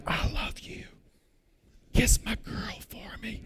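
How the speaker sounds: breathy farewell whisper, voice barely there; desperate whisper. Retold or imitated. A terrified man whispers goodbye to his family, whispered. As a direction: whisper a last goodbye as if it's over. Recording final goodbye, fearing death.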